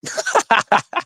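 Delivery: short high-pitched laugh; laughing at his own falsetto. Real, not acted. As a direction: giggle after the joke.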